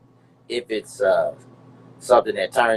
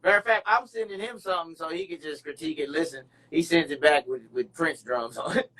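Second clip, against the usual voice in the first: ordinary delivery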